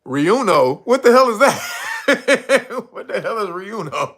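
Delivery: normal talking voice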